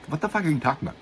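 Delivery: high incredulous protest; mock-outraged, voice shooting up; retold disbelief. Retold protest at another round.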